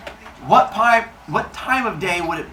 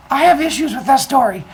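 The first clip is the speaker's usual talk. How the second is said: mock kid objection; 7 semitones higher, 4 dB louder than usual.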